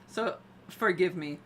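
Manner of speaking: loud hammed-up French accent, laughing at herself; mock French accent. Shown as a demonstration. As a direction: try a bad French accent as a joke. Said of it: "start speaking in a French accent".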